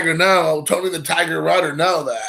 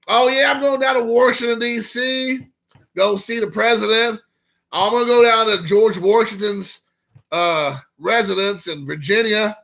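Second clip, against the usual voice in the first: put-on Southern accent; 5 semitones higher than usual. Mocking how Southerners say Washington, light Southern accent. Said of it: "You know, Washington".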